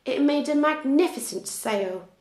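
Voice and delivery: ordinary delivery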